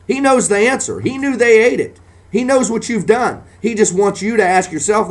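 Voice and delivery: rising preacher's cry; fiery preaching. Acted. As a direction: climb into a fiery preaching peak. Preaching climax.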